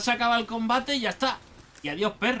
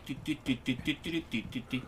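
soft, light run of "ti" beeps; playful disco blip noises; imitated beeping; 5 semitones lower, 8 dB quieter than usual. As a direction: mimic little electronic blips, quietly. Mimics disco lights or music.

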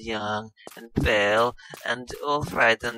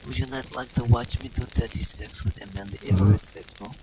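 hammed-up seductive British voice; sultry Lara Croft parody; 4 dB louder than usual. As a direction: purr it like a vamping game heroine.

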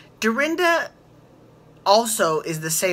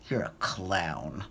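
much lower, much softer, slow cold put-down; icy deadpan housewife insult; cutting insult; 10 semitones lower, 10 dB quieter, much slower than usual. Retold or imitated. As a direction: drop it slowly like a knife. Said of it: "She was like".

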